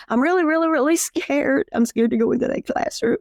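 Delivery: high, whiny imitation of a nervous new teacher, stretching the last "really"; mock scared teacher. Coach imitating a new teacher dreading the classroom, mock fearful and high. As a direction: play the frightened trainee, voice up high. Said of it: "they'd be like".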